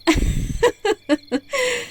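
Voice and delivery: high, tittering laugh; amused villain laugh. Acted. Spellcaster amused by the victim's literal obedience, high laugh. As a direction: burst into high, delighted giggles.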